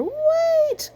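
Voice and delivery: high desperate cry; cried out. A narrator's reading. Reading aloud, Sheep crying out as the tractor leaves. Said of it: "cried Sheep".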